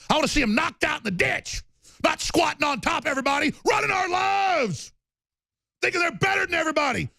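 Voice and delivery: high, furious shout at the elites; angry shouting. Real, not acted. Host raging at elites on his show, furious shout. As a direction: yell it, fed up and enraged. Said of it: "really angry".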